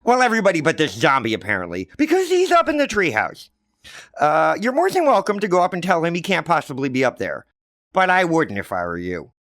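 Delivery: high, loud and fast, slyly warning; hint at the danger with a wink; knowing kid. Acted. Johnny slyly warns his sister off the treehouse, high and knowing.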